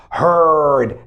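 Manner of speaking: ordinary talk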